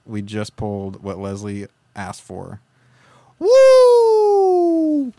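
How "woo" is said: high, loud triumphant whoop; excited whoop. Real, not acted. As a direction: let out a huge whoop. Shocked celebration after lucky pull.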